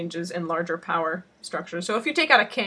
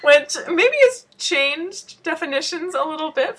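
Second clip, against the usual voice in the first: laughing; 7 semitones higher, 4 dB louder than usual. Real, not acted. Joking about the word skanky.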